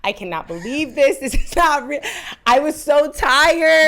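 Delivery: high, loud, slowing; cringing, breathless disbelief; mortified. Real, not acted. Mortified apology and aftermath.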